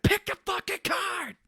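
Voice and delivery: high, slow snarled threat; hammed-up crazed demand; mock menacing Cage. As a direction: drag it out with menace.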